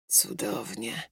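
low, very soft murmur; contented murmur. A narrator's reading. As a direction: murmur it low under your breath. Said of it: "mruknął".

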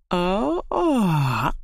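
high yawning "o", sliding down; sleepy yawn. A narrator's reading. Hedgehog Igo waking in his den, sleepy yawn. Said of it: "ziewnął zaspany Jerzyk".

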